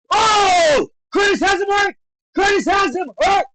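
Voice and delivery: high, loud play-by-play frenzy; excited shouting. Real, not acted. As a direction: shout the call as the fighter wobbles. Calling a fighter wobbling live.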